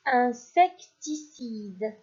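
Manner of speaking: "insecticide" said very slowly, syllable by syllable; slow dictation. Shown as a demonstration. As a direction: stretch out "insecticide" so children can spell it. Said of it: "Quatrième mot".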